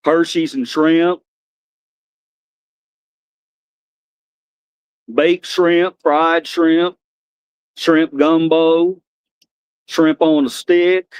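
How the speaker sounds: lightly voiced slow country character; Bubba. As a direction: list each dish slowly with a hint of country. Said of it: "he heard me doing the Bubba voice".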